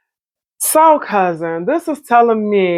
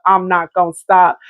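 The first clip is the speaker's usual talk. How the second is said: ordinary talk, nothing marked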